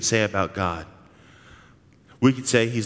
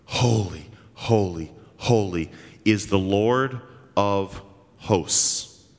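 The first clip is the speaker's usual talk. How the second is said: slow, low and hushed, each holy weighed; reverent solemn call; 4 semitones lower, much slower than usual. Acted. Angels calling across the throne room, slow and solemn. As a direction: intone it like awed angels before the throne.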